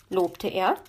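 plain reading voice

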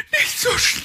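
very high breathless gasp; panting. A narrator's reading. Magpie panting behind the racing fox, very high and breathless. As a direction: gasp it out, barely keeping up. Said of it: "keuchte Frau Elster".

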